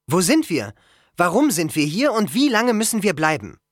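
lightly voiced demand for answers; demanding Jason. A narrator's reading. Newcomer wants answers about where they are, mildly insistent.

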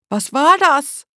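high, loud, startled shout; frightened cry. A narrator's reading. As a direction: cry out in alarm. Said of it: "rief Greta erschrocken". (erschrocken und laut gerufen)

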